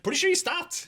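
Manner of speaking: high-pitched, incredulous retort; imitated disbelieving fan. Retold fan doubts the storyteller.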